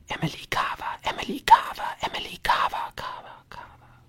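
voiceless haunting whisper, fading away at the end; ghostly whisper. A narrator's reading. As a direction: whisper the name like circling ghosts. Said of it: "Geisterhaftes Flüstern". (geisterhaftes Flüstern, das verhallt)

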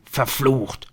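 plain reading voice

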